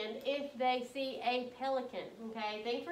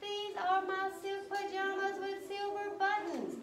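indignant Geronimo; 7 semitones higher, slower than usual. Acted. Geronimo indignant over pajamas.

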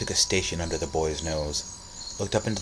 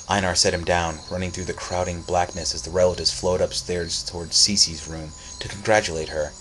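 usual speaking voice

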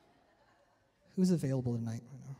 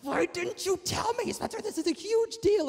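shocked teacher; 13 semitones higher than usual. Retold or imitated. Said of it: "She was like".

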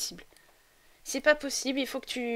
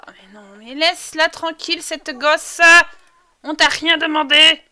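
loud, high telling-off; scolding; 4 semitones higher, 10 dB louder than usual. Pretend. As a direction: shoo the meddler away crossly. Telling off the Sim Louis.

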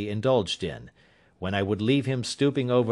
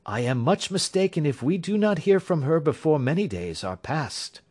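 higher, assured detective voice; Holmes; 6 semitones higher than usual. A narrator's reading. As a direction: Sherlock Holmes reassuring his worried client, higher assured voice.

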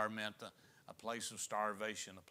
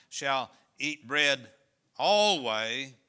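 "alway" slow and much louder; stress on alway; 12 dB louder, much slower than usual. Shown as a demonstration. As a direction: hammer the word "alway" home. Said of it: "underline that word always".

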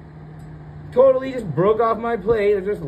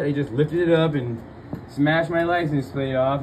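ordinary talk, nothing marked

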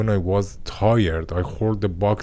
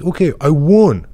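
1 triumphant cry; 6 semitones higher, 9 dB louder than usual. Retold or imitated. Recalls triumphant claim.

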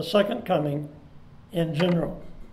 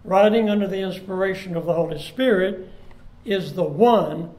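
louder, swelling sermon delivery; preaching; 4 dB louder than usual. Acted. Pastor urging congregation about the coming Lord, full preaching. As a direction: drive the point home from the pulpit.

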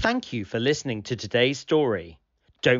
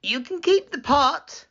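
high-pitched storybook woman's voice; lifted, high character voice; 13 semitones higher than usual. Acted. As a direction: speak up high as the woman in the tale.

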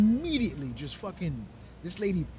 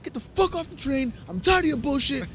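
higher, louder furious telling-off; retold angry yell; 8 semitones higher, 4 dB louder than usual. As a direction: tear into her from the platform. Narrator yells at lady on platform.

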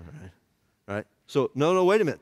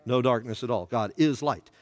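ordinary talk

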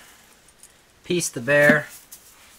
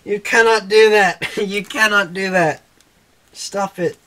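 mock scolding; 5 semitones higher, 4 dB louder than usual. Real, not acted. Scolding the dog playfully.

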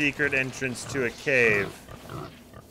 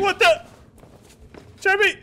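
jolted, cry out to your partner; startled shout; 4 dB louder than usual. Real, not acted. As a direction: yelp of shock, much higher and louder. Sudden surprise at enemy.

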